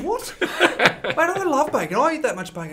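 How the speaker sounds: high, incredulous, laughing; mock shock. Retelling his reply to Dean about bacon, high and laughing. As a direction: squeal it like the idea is absurd.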